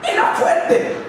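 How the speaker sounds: high, laughing cry of wonder; amazed exclamation. Acted. Preacher marvels to the congregation at the demoniac's strength, high and laughing.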